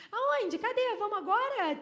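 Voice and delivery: high, loud, acted surprise; startled questioning. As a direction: burst out with eager questions. Acting out startled woman rushing out.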